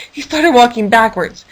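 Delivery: ordinary delivery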